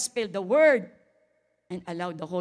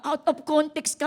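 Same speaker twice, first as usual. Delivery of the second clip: loud, high "Out of context ka"; rebuke; 8 semitones higher, 7 dB louder than usual. Acted. Pastor scolding Brad, loud and high. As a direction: call it out firmly. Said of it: "repeated rebuke".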